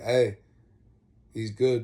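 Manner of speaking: farmer calls out loudly to stop the guards; loud, firm call to back off. Retold or imitated.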